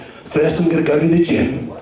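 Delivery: lightly voiced tough guy; a hint of a gruff action hero; Jason Statham. Imagining the action star on his day off, a touch gruff. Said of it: "I can imagine Jason Statham on his day off".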